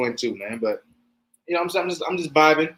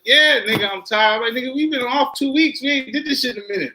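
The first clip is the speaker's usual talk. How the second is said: tired complaint; 7 semitones higher than usual. Retold or imitated. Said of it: "'cause I was tired".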